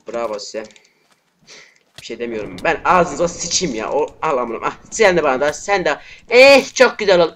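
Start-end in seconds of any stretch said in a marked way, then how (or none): 2.6-7.3 real gamer rage, loud; furious shout. Real, not acted. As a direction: spit rage at the screen. Raging at enemy players.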